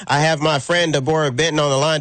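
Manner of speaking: ordinary talk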